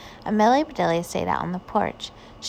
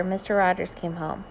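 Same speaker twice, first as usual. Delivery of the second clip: ordinary delivery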